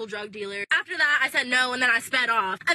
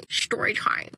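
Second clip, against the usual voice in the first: whispered story time; slower than usual. Shown as a demonstration. Recording the story intro, whispered. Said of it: "it feels hella weird saying that".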